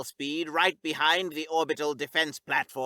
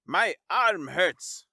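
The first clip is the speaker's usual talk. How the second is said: whined; 4 semitones higher, 3 dB louder, slower than usual. Acted. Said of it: "Tuller whined".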